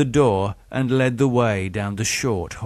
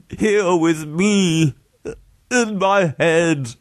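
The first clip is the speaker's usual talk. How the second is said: slow, high plaintive voice; piteous; 8 semitones higher, slower than usual. A narrator's reading. Haunted victim confessing voices in his head, piteous. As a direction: plead slowly and pitifully. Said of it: "said Charles piteously".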